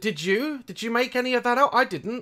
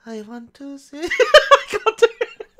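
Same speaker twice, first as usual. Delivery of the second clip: giggling through the try; laughing attempt; 7 semitones higher, 3 dB louder than usual. Real, not acted. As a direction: try to sing, break into laughter. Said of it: "can I even sing like that".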